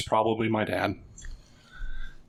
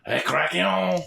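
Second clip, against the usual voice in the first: mock French accent; 6 semitones higher, much slower than usual. French giant bit continues.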